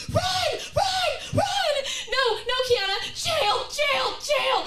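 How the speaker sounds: mock panic; panicked yelling. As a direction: shriek it while bolting. Panicked fleeing.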